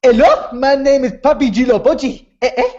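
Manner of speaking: put-on African accent introducing himself. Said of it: "Can I do, say his name in an African accent?".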